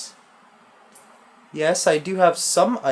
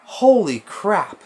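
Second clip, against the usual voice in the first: slow, laughing and much higher; nervous laughter; 8 semitones higher, 5 dB louder than usual. Real, not acted. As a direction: laugh off the fright in disbelief. Nervous laughter after a scare.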